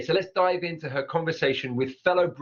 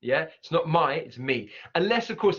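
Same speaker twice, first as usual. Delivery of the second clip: ordinary talk, nothing marked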